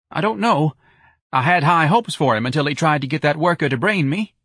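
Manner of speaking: high, anxious reply; timid office manager. A narrator's reading. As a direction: explain it in a piping voice. Nervous office manager answering.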